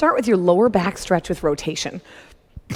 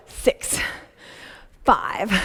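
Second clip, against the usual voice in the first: ordinary delivery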